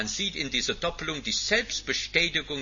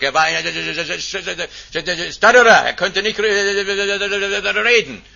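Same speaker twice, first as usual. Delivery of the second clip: slow, strained, louder halting words; a touch of Moses struggling to speak; halting stammer; 6 semitones higher, 9 dB louder, much slower than usual. Acted. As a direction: act out a man who can't get words out. Preacher voicing stammering Moses.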